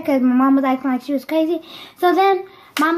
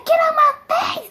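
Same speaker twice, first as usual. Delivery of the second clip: very high, strained mock screech; imitated yelling mom; 13 semitones higher than usual. Mom yelling at her crying child, mock screech. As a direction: scream it like a mother in a rage. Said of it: "she yelled at me".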